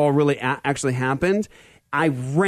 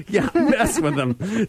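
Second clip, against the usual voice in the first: slow, higher, through real laughter; words broken up by laughing; 4 semitones higher, much slower than usual. Real, not acted. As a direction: say it while cracking up. Laughing along with co-host's joke.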